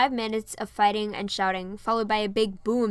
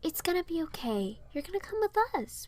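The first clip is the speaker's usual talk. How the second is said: higher, soft, gentle; kindly; 8 semitones higher, 5 dB quieter than usual. A narrator's reading. Rapunzel gently tells Piper she'll come along, kindly. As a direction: comfort her like a big sister. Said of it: "Rapunzel said kindly".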